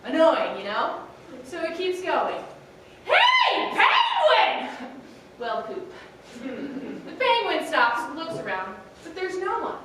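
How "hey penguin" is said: very high, loud, screechy bird voice; screechy girl albatross calling out. A narrator's reading. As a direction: shriek it like an annoying seabird. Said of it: "the voice is high-pitched and screechy".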